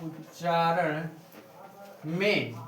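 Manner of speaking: उच्चारण drawn out slowly and softly; soft, very slow single word; slow dictation. Shown as a demonstration. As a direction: dictate उच्चारण at writing speed.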